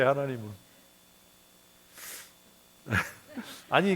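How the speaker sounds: ordinary talk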